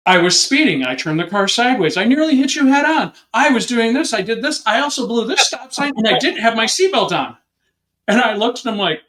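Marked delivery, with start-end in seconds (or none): 0.1-7.4 panicked confession. Retold or imitated. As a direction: blurt out every offense in one terrified breath. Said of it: "This kid takes this huge breath".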